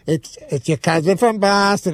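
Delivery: slow mocking Boston accent. Mocking Boston fans from the toilet stall, Boston accent. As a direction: drawl the line like a Boston beer ad. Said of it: "I thought I was, like, whispering".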